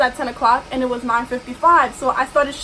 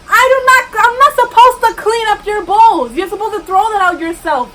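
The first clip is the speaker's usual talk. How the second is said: loud, higher, exasperated scolding; exasperated outburst; 6 semitones higher, 7 dB louder than usual. Real, not acted. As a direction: let it burst out at the rude customer. Venting at the customer.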